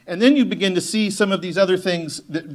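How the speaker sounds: ordinary talk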